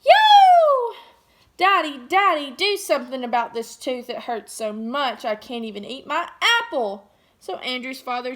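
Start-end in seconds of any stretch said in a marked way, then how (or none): 0.0-1.0 huge high shouted yo; yelled. Acted. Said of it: "and yelled". 1.6-7.1 loud pleading shout to daddy; big hammed-up call for help; yelling. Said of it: "and yelled".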